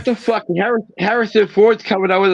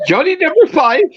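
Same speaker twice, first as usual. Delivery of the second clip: Johnny Five; 13 semitones higher than usual. Acted. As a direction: mimic an excitable film robot, pitched way up. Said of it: "every time I think of an Indian person".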